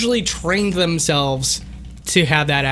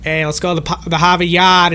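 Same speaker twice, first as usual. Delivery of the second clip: mock Boston cop, dropped R's, louder at the end; movie-cop Boston accent; 4 dB louder than usual. Streamer mimicking cops in movies, exaggerated Boston accent. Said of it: "all the cops in the movie are like".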